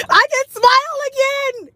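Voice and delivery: high, slow, beaming; delighted. Real, not acted. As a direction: say it with joy. Showing off fixed tooth.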